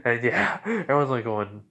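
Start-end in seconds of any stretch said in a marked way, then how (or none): none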